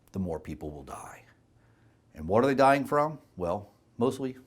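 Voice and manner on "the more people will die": grim whisper. Real, not acted. Grim warning after contrast.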